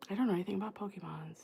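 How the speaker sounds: quick, hushed whisper; fast whispered confession; whispered aside. Real, not acted. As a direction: admit it quickly under your breath. Aside admitting ignorance of Pokemon.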